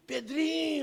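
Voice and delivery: put-on high, drawn-out drunken call; slur-happy hail of an old acquaintance; drunk man greeting. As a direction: imitate a drunk calling a name. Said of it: "um cara com aspecto de bêbado".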